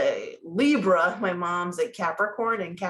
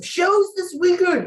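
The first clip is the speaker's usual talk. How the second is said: excited; 9 semitones higher, 7 dB louder than usual. Real, not acted. Excited about weekend comedy shows.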